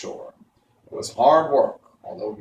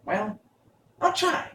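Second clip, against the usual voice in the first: higher bird voice; a sharp-eyed stork, pitched up; 10 semitones higher than usual. A narrator's reading. Stork correcting the travellers, higher voice. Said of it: "said the stork".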